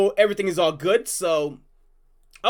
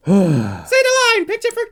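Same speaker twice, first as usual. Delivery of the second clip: cartoon voice; 10 semitones higher, 6 dB louder than usual. Urging the character.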